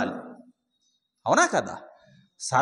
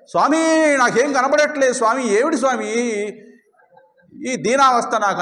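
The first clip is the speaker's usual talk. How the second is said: raised, louder anguished plea to the Lord; pleading devotee; 5 semitones higher, 3 dB louder than usual. Retold or imitated. Blind devotee begging the Lord, high and pleading. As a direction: cry out to god, helpless and blind.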